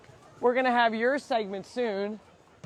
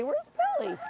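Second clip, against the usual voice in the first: high sing-song call; high playful call to a goat; faster than usual. Real, not acted.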